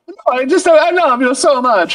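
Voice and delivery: very high, pleading cartoon voice; hammed-up desperate suitor; lovesick Mario. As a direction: plead your love in a squeaky falsetto. Acted Mario pleading with Bowser.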